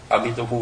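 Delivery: A Di Đà Phật as Chinese "a-mi-to-fu"; phát âm kiểu Tàu. Shown as a demonstration. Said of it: "rõ ràng". (đọc A Di Đà Phật thành "a-mi-tô-phu")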